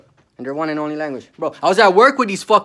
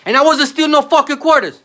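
loud, high outraged yelling; loud high furious yell about being blamed; furious shout; 9 semitones higher, 8 dB louder than usual. Real, not acted. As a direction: shout it out in real fury.

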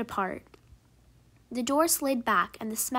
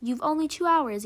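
calling out; 5 semitones higher, 5 dB louder than usual. A narrator's reading. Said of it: "called the teacher after them".

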